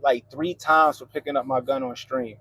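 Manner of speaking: ordinary talk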